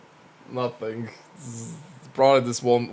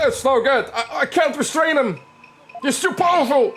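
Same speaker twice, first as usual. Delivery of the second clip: much higher, strained frightened guard; panicked bailiff; 14 semitones higher, 4 dB louder than usual. Acted. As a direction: squeak it out in panic. Bailiff failing to restrain.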